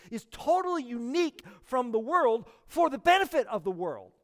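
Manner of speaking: ordinary delivery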